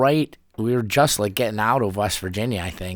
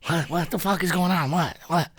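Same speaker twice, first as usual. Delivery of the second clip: groggy panic; 8 semitones higher, 3 dB quieter than usual. Retold or imitated. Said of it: "I fucking passed out".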